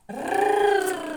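Р as a long, high rolled trill. Shown as a demonstration. Teacher showing how the tongue trembles, long trilled Р. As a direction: roll Р loudly on the tongue. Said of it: "дрижить у".

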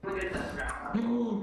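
high sudden gasp; sharp pained gasp. Real, not acted. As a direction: gasp from a hit. Being hit.

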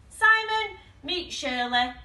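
mildly hopeful introduction, name called high. A narrator's reading. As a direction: introduce a friend with a touch of hope. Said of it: "said Ted hopefully".

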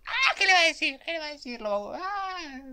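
very high, breathless anticipation; excited squealing. Real, not acted. Viewer waiting to hear what he says, excited squeal. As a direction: squeal the question in suspense.

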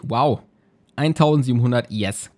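normal talking voice

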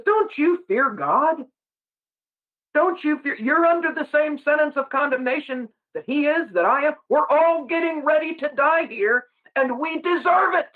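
high, earnest scolding; rebuking. Retold or imitated. Penitent thief rebuking the other thief, earnest. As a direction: straighten out his friend firmly. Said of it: "He rebukes him".

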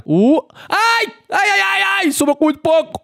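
very high, yelping "ai ai ai"; real tense high-pitched yelps; excited shouts. Real, not acted. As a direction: shriek with nervous excitement. Opponent nearly survives, tense moment.

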